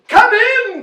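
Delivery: high, loud royal call; king's cry. A narrator's reading. King inviting them to fish, loud cry. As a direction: holler it out heartily. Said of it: "cried the king".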